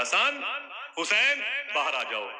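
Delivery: put-on high, loud call to the boys outside. Ali calls Hasan and Husain out of the house, loud calling voice.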